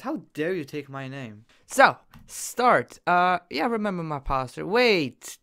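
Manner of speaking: ordinary talk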